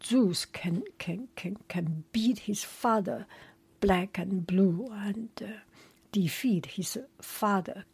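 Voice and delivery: ordinary delivery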